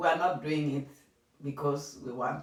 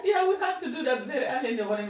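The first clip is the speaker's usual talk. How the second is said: fast, higher, laughing as she recalls; quick giggly answer; 9 semitones higher, faster than usual. Real, not acted. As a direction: laugh at the early-morning chores. Laughing recollection.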